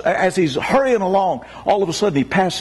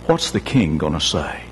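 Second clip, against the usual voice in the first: despairing servant. Acted. Servant despairs, repeats line.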